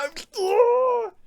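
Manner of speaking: a whale's anguished cry as the air guns fire; pained whale groan. Retold or imitated. Imitating a whale in pain from air guns, high anguished groan. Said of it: "the whales just go".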